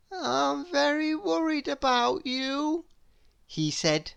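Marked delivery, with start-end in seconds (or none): 0.1-2.8 high, fretting little voice; anxious high character voice; fretful Mister Worry. Acted. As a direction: worry at him, pitched up.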